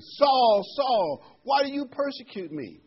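high, loud call of the voice from heaven; heavenly voice calling. Acted. The voice from heaven calling Saul to account, loud and high. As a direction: call out the name from above. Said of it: "heard a voice saying".

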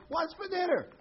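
high, drawn call to someone far off; acted yell. Husband yells a few feet closer to his wife, high mock yell. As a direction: holler it to a wife who won't hear.

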